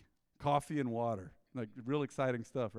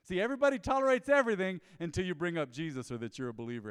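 normal talking voice

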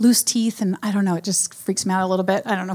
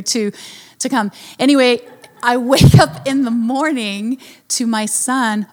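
usual speaking voice

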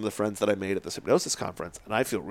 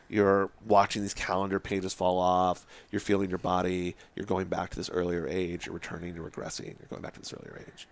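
low, soothing patter fading to a murmur; hushed, lulling countdown-style voice; hypnotist's induction; 4 semitones lower, 4 dB quieter than usual. Retold or imitated. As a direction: talk someone down into trance, getting softer. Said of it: "Drop her back down in a trance".

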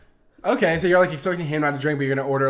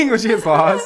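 laughing through the line, high and loud; amused retelling, laughing; 10 semitones higher, 10 dB louder than usual. Real, not acted. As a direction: giggle while telling it. Laughs while describing scene.

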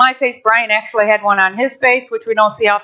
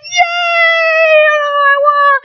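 excited cheer; slower than usual. Retold or imitated. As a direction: celebrate the win with a squeal. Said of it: "I went".

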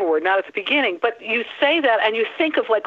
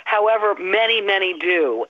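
ordinary talk

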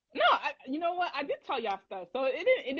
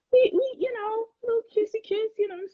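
coy giggly voice; 13 semitones higher, 4 dB louder than usual. Real, not acted. Coy about the kiss.

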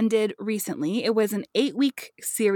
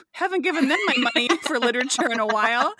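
laughing; 4 semitones higher than usual. Retold or imitated. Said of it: "I was like".

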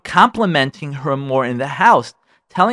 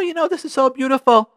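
gushing compliment; 10 semitones higher than usual. Shown as a demonstration. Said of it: "and tell her".

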